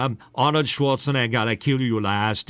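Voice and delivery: heavy Austrian action-star accent; Arnold Schwarzenegger. Shown as a demonstration. Voice actor demonstrating a movie star's catchphrase, in an Austrian accent. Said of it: "he talks like this".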